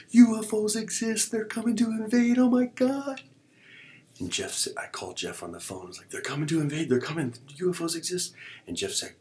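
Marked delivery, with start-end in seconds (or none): 0.1-3.2 very high, loud retold freak-out; hammed-up panic. Relives panic over aliens invading. 6.1-8.3 high, loud frantic alarm; retold panic. Relives panicked phone call.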